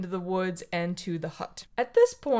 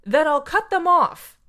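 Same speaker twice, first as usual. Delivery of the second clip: higher, louder mother snapping back; mom fed up; 6 semitones higher, 6 dB louder than usual. Retold or imitated. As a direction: fire it back, a lightly voiced fed-up mom. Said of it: "the mom says".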